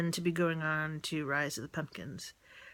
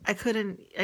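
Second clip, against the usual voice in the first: normal talking voice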